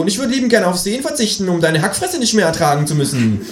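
very high creature voice; retorting kangaroo. A narrator's reading. Kangaroo insulted by its friend, retorting high. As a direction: fire back in a high animal voice.

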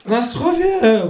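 very high, stretched-out toast; calling kangaroo. A narrator's reading. Kangaroo handing round vodka, high cheering call. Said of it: "ruft das Känguru".

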